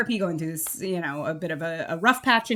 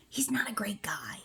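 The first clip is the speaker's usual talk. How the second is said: conspiratorial whisper; 11 dB quieter than usual. Real, not acted. Spoiler aside dropped to whisper.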